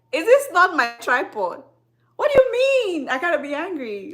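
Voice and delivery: loud, high-pitched incredulous protest; mock outrage. Creator to critics over her own channel, mock outrage. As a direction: raise pitch and volume in exaggerated indignation.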